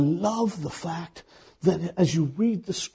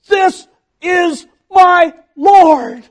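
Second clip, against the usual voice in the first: awed declaration; 14 semitones higher, 16 dB louder, much slower than usual. Acted.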